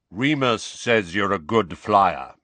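measured, unhurried deep-voiced wizard; a hint of a deep, slow voice. A narrator's reading. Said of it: "said Kingsley Shacklebolt in his deep voice".

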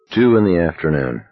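usual speaking voice

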